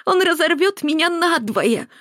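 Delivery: high, slow, tearful wail; sobbing wail. A narrator's reading. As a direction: wail it out in agony. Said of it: "рыдала Маргарита".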